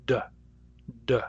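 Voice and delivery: d with a tiny stop of silence before release; true d. Shown as a demonstration. Teacher contrasting a true d with the flap. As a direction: hold a brief silence, then a clean d. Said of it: "a brief moment of silence in the middle".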